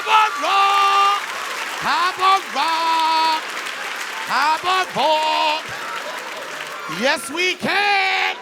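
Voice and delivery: high, loud, long-drawn fan cheering; swooning fan. Acted. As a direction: call out adoringly, stretching every word. Acting smitten fan at rally.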